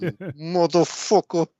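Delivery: slow, hammed-up Italian mobster accent; Italian Evil Corny. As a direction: drag it out like a cartoon Italian gangster. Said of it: "Evil Corny sounds Italian in my version".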